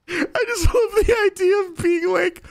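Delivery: high, teary words bubbling with laughter; laughing to tears. Real, not acted. Streamer to chat, giggling about the rude shop owner. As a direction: talk through tears of laughter. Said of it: "I gotta wipe the tears".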